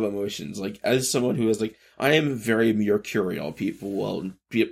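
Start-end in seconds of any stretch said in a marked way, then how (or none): none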